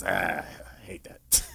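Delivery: muttered under the breath, slow; a slow, hushed, annoyed aside; whispered grumble. Retold or imitated. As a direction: grumble it to yourself so no kid hears. Tim's private grumble about kids.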